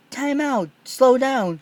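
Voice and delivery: high, loud flustered protest; hurried high-pitched stop; alarmed protest. Acted. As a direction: wave him off in a panic. Libby fending off proposal.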